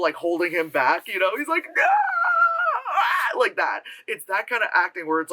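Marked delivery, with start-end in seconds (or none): 1.7-3.4 very high, drawn-out mock sob; hammed-up anguished cry; over-the-top wail. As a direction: scream it as if held back in grief. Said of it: "like, so over the top".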